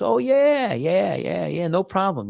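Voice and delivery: high acted yeah, easing down; eager assent. Gatekeeper tells traveller the dog may enter, eager assent. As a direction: play a welcoming gatekeeper saying of course.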